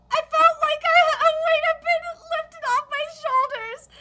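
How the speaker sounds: high, loud, tearful; reading through tears. Real, not acted. Reader finishing an emotional chapter, voice high and tearful. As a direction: push the line out while crying.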